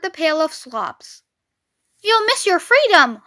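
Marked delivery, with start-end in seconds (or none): 2.0-3.2 high goose-like warning; honking goose. A narrator's reading. Goose pleading with Wilbur to stay free, honking. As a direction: honk out a warning. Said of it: "honked the goose".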